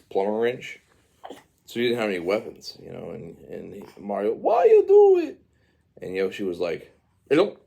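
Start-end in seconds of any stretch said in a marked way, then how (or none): 4.3-5.4 very high, loud cartoon cry; mock wail of protest. Mario to Yoshi after losing his weapons, high mock wail. 7.3-7.6 high, punchy creature chirp; Yoshi chirp. Acted. Storyteller voicing Yoshi eating the overalls, high chirp.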